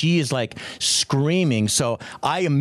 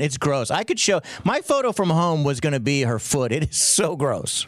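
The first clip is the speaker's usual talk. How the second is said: ordinary talk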